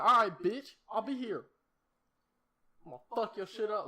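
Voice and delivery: loud, high, cocky trash talk; yelled threat. Retold or imitated. Kid yelling back at the bully at the bus. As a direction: bark the challenge back at him. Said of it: "I was like".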